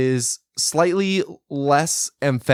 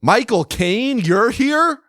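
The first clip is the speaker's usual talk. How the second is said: gushing host; 10 semitones higher, 4 dB louder, slower than usual. Pretend. Mock talk-show host greeting guest.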